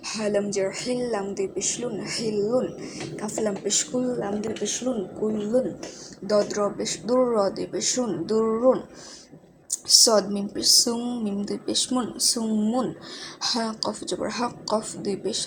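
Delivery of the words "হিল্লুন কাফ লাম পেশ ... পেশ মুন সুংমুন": ordinary talk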